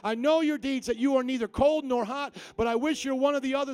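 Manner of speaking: high, loud preaching cry; forceful scripture declaration. Acted. Preacher reads the lukewarm warning to the church, loud and forceful. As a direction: thunder the verse at the congregation.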